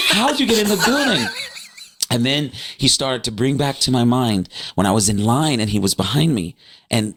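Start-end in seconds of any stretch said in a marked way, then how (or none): 0.1-1.2 quick, high-pitched bewildered question; baffled. Retold or imitated. Puzzling over how he got in, baffled. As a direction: repeat it fast in comic, high-pitched disbelief. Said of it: "I was trying to figure that out".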